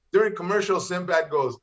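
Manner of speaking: normal talking voice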